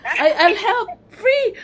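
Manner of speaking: high, loud and laughing; eager offer. Retold or imitated. Fan begging the producer to take free help, eager offer. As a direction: burst out volunteering, pitch climbing high.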